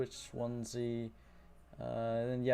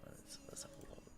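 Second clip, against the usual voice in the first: breathy whispered aside to himself; 18 dB quieter than usual. Real, not acted. Streamer whispers to himself while searching his screen, whispering. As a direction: drop to a private whisper while looking.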